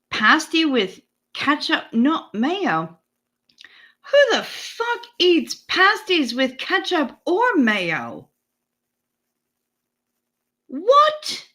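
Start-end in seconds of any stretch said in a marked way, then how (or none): 4.0-8.3 high, incredulous outburst; outraged disbelief. Real, not acted. Streamer reacting to chat's ketchup on pasties, outraged disbelief. 10.7-11.4 very high squawk of disbelief; shocked what. Real, not acted. Streamer hearing chat's pasty opinion, shrieked disbelief.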